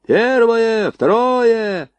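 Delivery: high, long-drawn firing call; stretched, high-pitched shout; yelling. A narrator's reading. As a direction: bellow each gun's turn. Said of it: "заорал Лепёхин".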